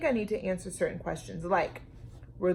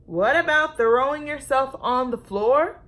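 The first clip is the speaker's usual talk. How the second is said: higher and louder question; dad puzzled; 5 semitones higher, 7 dB louder than usual. A narrator's reading. Dad to Lulu, higher and louder puzzled question. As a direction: ask it with puzzled disbelief. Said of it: "And her dad asked".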